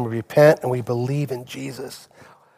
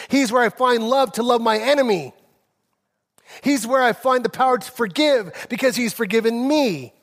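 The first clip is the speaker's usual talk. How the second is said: higher, driving preacher's cadence; fervent pulpit climax; full-force preaching; 9 semitones higher than usual. Acted. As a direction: lift the sermon to its peak. Pastor climbs into full preaching.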